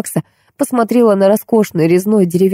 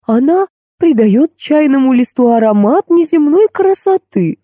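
gushing; 8 semitones higher than usual. Acted. Housekeeper boasts about tea. (восторженно, нараспев)

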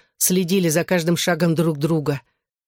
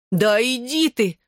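high, drawn-out disbelief; excited exclamation; 6 semitones higher, slower than usual. A narrator's reading. Friend hears about the kiss, exclaiming excitedly. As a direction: stretch it out high, thrilled and incredulous. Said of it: "громче и возбуждённее повторила она".

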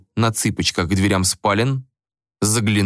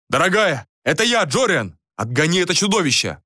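higher-pitched, acted calling out; urgent shout; 7 semitones higher than usual. A narrator's reading. Jorian fends off a dog, calling to his wife urgently. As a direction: call out urgently over the fight. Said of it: "Джориан закричал".

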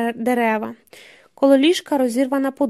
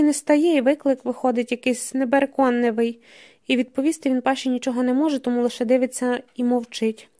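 ordinary delivery

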